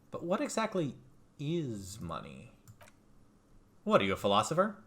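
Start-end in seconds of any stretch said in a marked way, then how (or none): 0.1-2.5 musing question. Acted. Pondering the question aloud.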